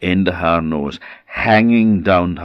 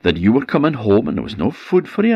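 eager wife explaining; 7 semitones higher, faster than usual. Acted. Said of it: "she said".

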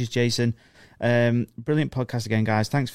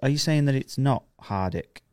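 usual speaking voice

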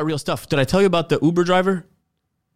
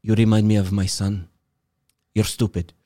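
low, deadpan imitation of his dismissal; retold put-down; 5 semitones lower than usual. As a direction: deliver a blunt insult in a lower voice. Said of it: "And he's like".

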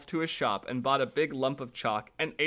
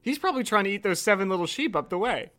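plain reading voice

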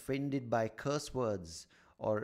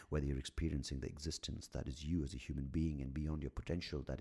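mock soulful; 6 semitones lower, 4 dB quieter than usual. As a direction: drift off into a fake guru reverie. Mock deep soul-gazing monologue.

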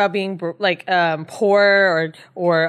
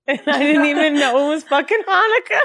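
laughing through the words; can barely get it out for laughing; 5 semitones higher than usual. Real, not acted. Retelling the car joke, laughing through it. Laughing while telling the story.

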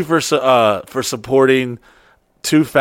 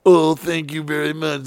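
fake thanks; 3 semitones higher than usual. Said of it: "fake bullshit".

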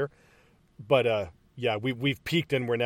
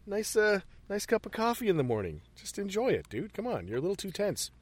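soft, higher-pitched coaxing to calm down; mock soothing; 5 semitones higher, 8 dB quieter than usual. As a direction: gently talk an overwrought pal down, playfully. Soothing the raging hero, in jest.

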